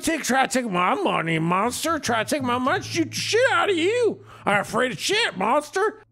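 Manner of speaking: high, wild gun-waving threat; threatening drunk. Acted. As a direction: rant like a crazed gunman. GM voicing threatening NPC.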